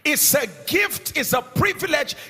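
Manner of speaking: preacher charging the crowd, loud and sung; full-force preaching. Acted. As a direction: thunder it like a climax.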